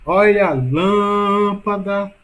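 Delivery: lâmpada called loudly with LÂM held very long; stress on LÂM. Shown as a demonstration. Teacher showing the longest syllable in lâmpada, LÂM stretched out. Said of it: "Sílaba mais longa".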